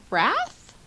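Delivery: usual speaking voice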